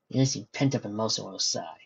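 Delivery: ordinary delivery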